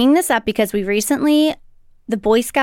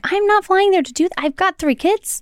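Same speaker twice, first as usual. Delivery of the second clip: higher-pitched, mildly exasperated refusal; retold protest; 8 semitones higher than usual. Daughter refusing to fly out to mom, retold protest. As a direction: push back as if refusing mom.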